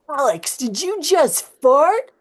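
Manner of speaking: put-on high, loud teen boys squabbling; hammed-up teenage boys; bickering boys. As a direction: squeal the accusation. Boys bickering about a fart.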